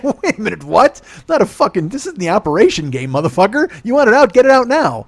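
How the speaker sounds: loud, high retold indignant rant; mock outrage at doctors. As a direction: blow up at the surgeon in disbelief. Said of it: "I'm like".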